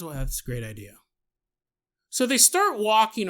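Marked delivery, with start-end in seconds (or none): 0.0-1.0 lower, softer voice for the woman; a lightly voiced hesitant woman; low, soft agreeable reply; Nancy's voice. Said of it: "Nancy goes".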